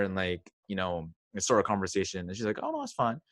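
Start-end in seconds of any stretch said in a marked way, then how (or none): 2.6-3.2 put-on much higher, light falsetto girl; mock girl voice. [name] voicing the girl he approached, high mock falsetto. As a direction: squeak it out as the girl.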